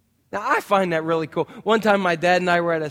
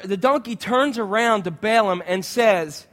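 normal talking voice

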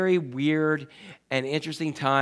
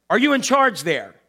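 mildly angry demand, raised pitch; angry caller; 5 semitones higher, 4 dB louder than usual. Retold or imitated. Angry caller demands whoever is in charge, mock irritation. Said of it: "The caller angrily says".

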